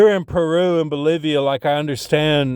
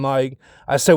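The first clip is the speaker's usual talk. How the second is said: usual speaking voice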